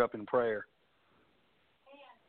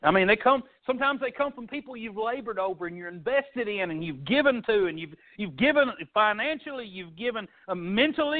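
higher, rising preacher's cadence building on each "given"; fervent preaching; 6 semitones higher than usual. Acted. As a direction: pour it out to the congregation like a revival sermon. Pastor preaches about betrayal.

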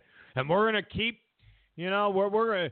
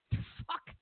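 whispered curse; 8 dB quieter than usual. Real, not acted. Exasperated reaction to shaming idea.

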